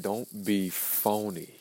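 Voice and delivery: ordinary talk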